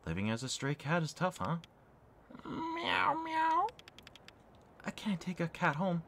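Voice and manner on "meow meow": two high meows; cat meows. Acted. Stray cat answering with high meows. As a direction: meow high like a kitten.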